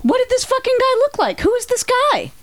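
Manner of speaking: who on earth is this guy; incredulous. Real, not acted. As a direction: friend reacts in disbelief to the free-stuff story. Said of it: "incredulous questions".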